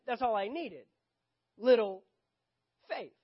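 high, loud, aggrieved complaint; indignant protest. Acted. Disciple in the boat argues with Jesus, indignant.

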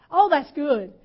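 ordinary talk

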